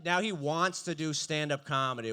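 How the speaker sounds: normal talking voice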